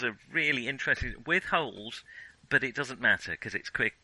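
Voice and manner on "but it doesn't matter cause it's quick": usual speaking voice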